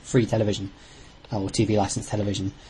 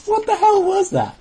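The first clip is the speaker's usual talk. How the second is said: high-pitched, astonished outburst; incredulous; 4 dB louder than usual. Real, not acted. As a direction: react in disbelief to a terrible accent. Baffled by co-host's bad accent.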